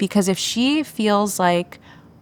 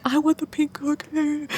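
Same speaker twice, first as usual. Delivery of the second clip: high, whiny mock sob; imitated crying child; 8 semitones higher, 4 dB quieter than usual. Mom imitating her daughter's crying friend, whiny mock crying. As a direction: whine it like a tearful preschooler. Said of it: "the friend's crying".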